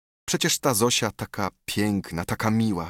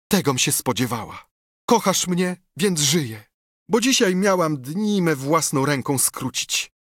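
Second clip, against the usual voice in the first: higher, rapturous woman's voice; ardent woman's plea; 7 semitones higher than usual. A narrator's reading. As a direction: gush out your devotion, pitched high. Telimena declares her love.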